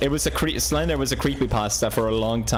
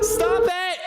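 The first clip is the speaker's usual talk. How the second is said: terrified shriek. Real, not acted. Monster chasing in game.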